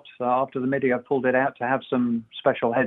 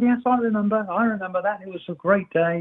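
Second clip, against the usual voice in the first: high-pitched mimicry of eager remembering; all excited; 7 semitones higher than usual. Racer recalling a sailing day, mimicked excitedly. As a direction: gush as someone reliving a great memory. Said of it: "he got all excited".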